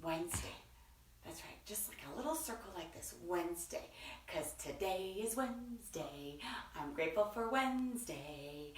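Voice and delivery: soft, low teaching voice; hushed. Real, not acted. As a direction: say it low and very softly. Models a word very softly.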